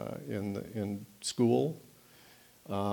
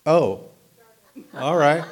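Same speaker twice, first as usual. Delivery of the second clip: raised voice as mic comes on; louder all at once, caught off guard; loud, sudden oh; 5 semitones higher, 10 dB louder than usual. Real, not acted. Speaker at the mic realizing he's suddenly loud.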